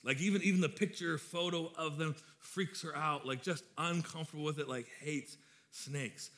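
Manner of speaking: normal talking voice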